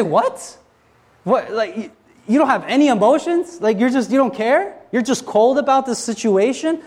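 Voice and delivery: high, incredulous outrage at a cold partner; shocked disbelief. Retold or imitated. Betrayed spouse confronts cheating partner, high shocked disbelief. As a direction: voice climbing in stunned disbelief.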